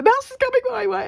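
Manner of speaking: the panic pushes the voice way up; voice going high. Retold or imitated. Woman sees the mouse running at her, voice shoots high. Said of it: "my voice was getting high".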